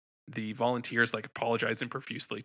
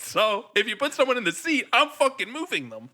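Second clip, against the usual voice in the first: high, loud, furious yell; furious shout; 11 semitones higher, 9 dB louder than usual. Retold or imitated. Guy yells at theater staff over his seat, furious. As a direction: blow up at the staff.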